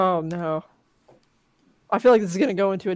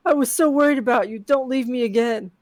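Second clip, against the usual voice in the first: usual speaking voice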